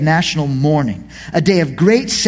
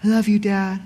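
soft, lightly voiced little kid; tender child saying goodnight; gentle hint of a child; small child's voice; 3 semitones higher, 5 dB quieter than usual. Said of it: "this little voice say".

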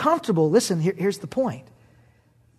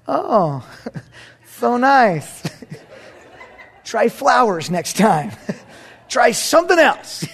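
higher, through laughter; gracious but unimpressed; laughing mock wife; 4 semitones higher, 4 dB louder, much slower than usual. Wife hinting to her husband about flowers, laughing. Voicing wife's polite disappointment.

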